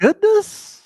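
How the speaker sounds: high, loud mock gasp of surprise; a squeaky startled goodness; goodness exclamation. Cohost hears breaking news, squeaks a high startled goodness.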